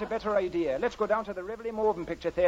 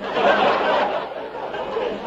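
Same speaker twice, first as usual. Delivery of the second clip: laugh; 6 dB louder than usual. Acted. Laughs mid-flirt.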